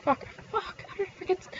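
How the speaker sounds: whispered self-scolding curses; muttered to self. Real, not acted. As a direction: hush it, cursing under your breath. Mutters curses to herself at forgetting.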